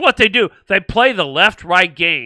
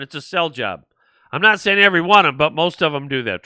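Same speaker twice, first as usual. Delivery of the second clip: ordinary talk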